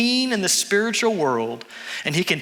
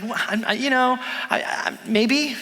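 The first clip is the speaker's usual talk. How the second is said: stammering, higher-pitched hedge; hesitant; 6 semitones higher than usual. Retold or imitated. Tourist answering men who say he's lying, hesitant. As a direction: stall and fumble for an answer. Speaker retells being called a liar.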